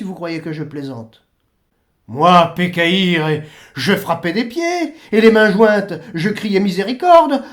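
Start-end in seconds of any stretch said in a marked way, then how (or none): 2.1-7.4 imploration forte. Retold or imitated. Le curé désespéré implore.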